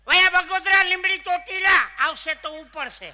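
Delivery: very loud, high bus-stand bellow; bus conductor shouting. Retold or imitated. Conductor calls out the route to passengers, bellowing. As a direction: holler the route across the depot. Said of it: "બસ સ્ટેન્ડમાં ઊભા રહીજો".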